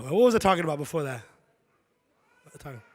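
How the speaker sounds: usual speaking voice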